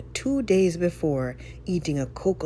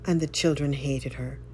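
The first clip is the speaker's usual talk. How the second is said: ordinary delivery, nothing marked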